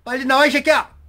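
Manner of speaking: loud, high barked order; angry shout. Retold or imitated. Soldier orders the hiding fugitive out, angry shout. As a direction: yell him out of hiding.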